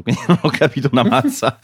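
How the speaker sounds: laughing through words. Real, not acted. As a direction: giggle out the admission. Laughs while admitting confusion.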